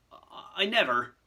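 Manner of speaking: falter, then protest; imploring. Acted. Hagrid protesting his innocence to Fudge, imploring. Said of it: "looking imploringly at Dumbledore".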